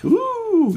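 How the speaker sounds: high-pitched, held "ooh" of mock awe; admiring ooh. Host imitating people admiring Shaker chairs, high admiring ooh. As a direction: coo a long, very high "oooh".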